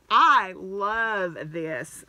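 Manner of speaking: slow, lingering I love this; heartfelt delight. Real, not acted. Admiring the star-lined bag, slow and fond. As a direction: drag it out with fond pleasure.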